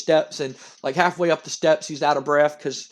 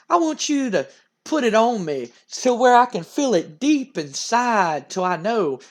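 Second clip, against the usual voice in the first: over-the-top wrestler; 7 semitones higher than usual. Acted. Wrestler egging on his tag partner backstage, hammed-up and suggestive. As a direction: stretch it out suggestively in a high put-on voice. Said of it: "Dude goes".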